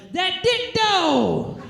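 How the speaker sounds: stretched-out cry mid-sex; involuntary shout. Retold or imitated. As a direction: blurt it out in the heat of the moment. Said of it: "I just shouted".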